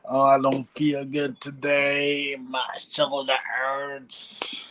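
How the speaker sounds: mock whiny, slow complaint; whiny complaining. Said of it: "no backing out, imagined whining".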